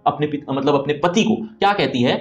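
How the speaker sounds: ordinary talk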